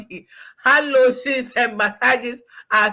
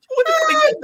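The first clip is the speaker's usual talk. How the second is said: incredulous; 7 dB louder than usual. Retold or imitated. Incredulous outcry.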